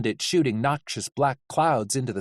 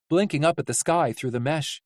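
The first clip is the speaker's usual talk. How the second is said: usual speaking voice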